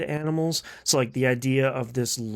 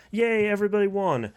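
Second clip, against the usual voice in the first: higher-pitched pretend celebration; mock cheer; 7 semitones higher than usual. Parent placating a crying four-year-old, mock cheer. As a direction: cheer it as if placating a small child.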